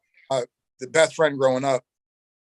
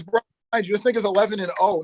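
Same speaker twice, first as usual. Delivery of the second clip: ordinary delivery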